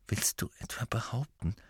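soft whisper; hushed voice. A narrator's reading. Hermine doubting Harry, hushed whisper. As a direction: whisper it in disbelief. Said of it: "mit gedämpfter Stimme".

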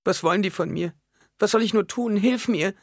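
put-on very high, desperate child's wail; begging little girl. A narrator's reading. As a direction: plead for help like a helpless small girl. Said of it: "mit der Stimme eines kleinen Mädchens". (verzweifelt bettelnde Kinderstimme)

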